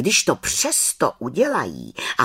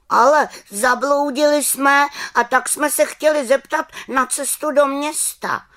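put-on high, louder child voice; boy's voice; 14 semitones higher, 6 dB louder than usual. Lost boy asks the witch the way, high voice. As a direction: pipe up like a lost little boy. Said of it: "řekl chlapec".

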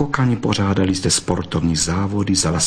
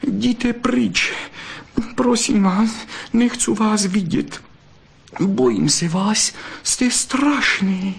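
high, pleading vixen voice, rising in fear; frightened pleading; 12 semitones higher, slower than usual. Acted. Vixen begs the fox to let her go, high and frightened. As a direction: beg him to let go, scared and breathless.

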